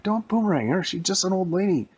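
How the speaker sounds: high-pitched pleading sidekick voice; Robin. Acted. Sidekick urging Batman not to boomerang an old lady, high-pitched protest. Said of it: "And Robin was like".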